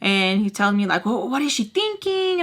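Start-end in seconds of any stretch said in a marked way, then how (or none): 1.1-2.4 high, whiny quoted father; mocking her father's complaint; mock dad whine. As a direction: lightly mimic the nagging dad. Quoting father.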